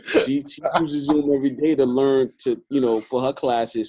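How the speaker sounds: ordinary talk, nothing marked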